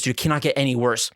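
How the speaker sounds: ordinary talk, nothing marked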